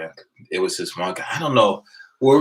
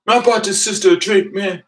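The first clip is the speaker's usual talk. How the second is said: whiny complaint; 12 semitones higher, 4 dB louder than usual. Retold or imitated. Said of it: "He's like".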